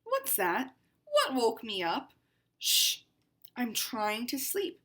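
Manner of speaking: picture book read as a proud cat; lightly voiced haughty cat; cat narrator, read aloud. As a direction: storytime reading to children.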